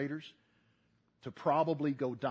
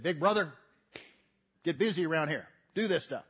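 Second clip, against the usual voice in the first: mock bossy, a bit louder; bossy order; 3 semitones higher, 6 dB louder than usual. Little brother bossing big brother around, mock bossy. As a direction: play the pushy little brother giving orders. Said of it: "never walked up to him, said".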